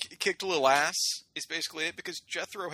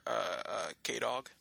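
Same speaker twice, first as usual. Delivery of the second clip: ordinary talk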